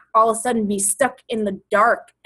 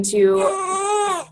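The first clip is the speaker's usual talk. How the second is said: words pushed out through real crying; 10 semitones higher than usual. Real, not acted. Host telling viewers her reason for working, then crying. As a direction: voice breaking into tears, very slow.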